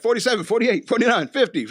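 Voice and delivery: high, loud cartoon count; mock cartoon counting. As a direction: count it off like a goofy cartoon hero.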